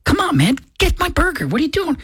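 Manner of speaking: high, whiny impatience at the stalled driver; impatient groan. Pretend. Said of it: "You're like".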